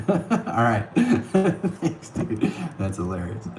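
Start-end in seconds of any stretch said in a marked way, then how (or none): none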